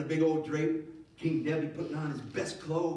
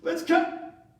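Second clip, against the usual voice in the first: much higher and louder call; director's cut; 6 dB louder, much slower than usual. Retold or imitated. King to his crew, shouting cut. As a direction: yell cut like a film director. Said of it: "King Nebi is like".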